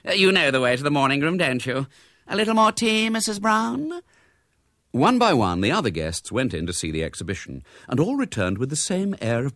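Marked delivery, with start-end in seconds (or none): 0.0-4.0 high, polite society lady; high-pitched social politeness; gushing hostess. A narrator's reading. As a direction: play the gracious tea-party hostess.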